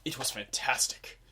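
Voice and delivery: normal talking voice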